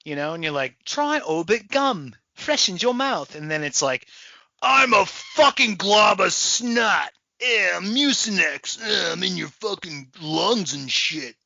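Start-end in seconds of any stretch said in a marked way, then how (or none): 0.8-3.3 slow, higher mock British sales pitch; British advert accent. Gum spokesperson selling freshness, mock British accent. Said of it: "anything that's clean or fresh is British". 4.6-11.3 drawn-out mock Brooklyn tough guy; Brooklyn accent. Disgusting-product mascot taunting the customer, exaggerated Brooklyn accent. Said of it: "Anything that's fucking disgusting is in Brooklyn".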